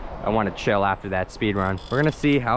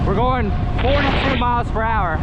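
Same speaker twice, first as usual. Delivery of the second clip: excited; 10 semitones higher than usual. Real, not acted. Fast scooter speed run.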